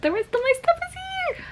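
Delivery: excited squeal. Real, not acted. Giddy about her delivered haul.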